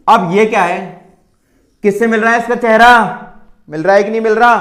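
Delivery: sing-song teasing address; teacher hamming up gentle talk; mock sweet coaxing. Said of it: "चलो प्यार से बात करते हैं".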